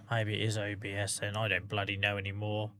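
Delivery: low, quiet resigned sigh; sigh, then give up softly; weary, low and defeated. Real, not acted. Giving up on technical trouble.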